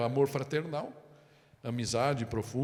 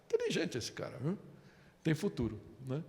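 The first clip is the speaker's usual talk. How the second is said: ironic praise; 2 semitones higher, 5 dB quieter than usual. Acted.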